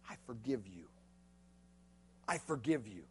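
very soft and low; hushed, tender master forgiving; tender forgiveness. Acted. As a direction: breathe it out gently, full of mercy.

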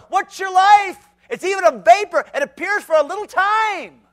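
high, loud scripture thundered out; preaching at full force. Acted. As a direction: hammer the verse at the crowd.

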